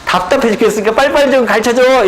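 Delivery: high-pitched mock impatience; impatient demand. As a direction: act out an exasperated learner pushing for answers. Voices an impatient learner's demand. (답답해서 재촉하는 흉내)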